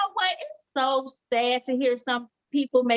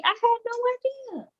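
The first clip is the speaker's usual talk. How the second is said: amazed; 10 semitones higher than usual. Real, not acted.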